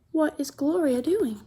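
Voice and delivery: ordinary delivery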